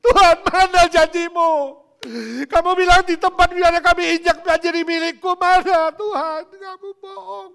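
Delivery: wailing, outraged scolding; shouted accusation. Acted. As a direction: rail at God at full force. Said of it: "Yang diomelin siapa? Tuhan".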